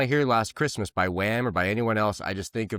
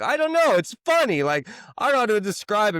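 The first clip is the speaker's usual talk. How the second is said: squeaky high, louder, sheepish; higher and louder, fumbling excuse to angry boss; awkward self; 10 semitones higher, 5 dB louder than usual. Retold or imitated. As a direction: shrug it off way up high. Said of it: "And I'm just like".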